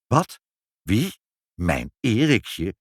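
astonished, disbelieving questions, each word set apart; opperste verbazing. A narrator's reading. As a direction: react with incredulity, drawing out the last question. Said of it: "riep mevrouw in opperste verbazing". (opperste verbazing, vol ongeloof)

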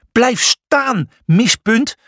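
bellowing, high-pitched angry order; Julius. A narrator's reading. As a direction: bellow at a rival to stop. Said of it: "bulderde Julius". (brullend, boos bevel)

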